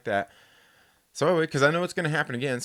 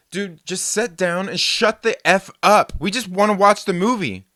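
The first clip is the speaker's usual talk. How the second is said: firm, louder "shut the F up", slow; retold telling off; 6 semitones higher, 7 dB louder than usual. Telling the loud man to sit down, louder and slow. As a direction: lay down the law to the talker. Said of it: "and I said".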